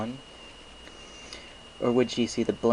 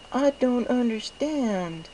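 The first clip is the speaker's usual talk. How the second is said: very high, slow, dreamy filly voice; dazed Derpy; much slower than usual. Acted. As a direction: say your name slowly as if half-lost. Derpy confused by kindness.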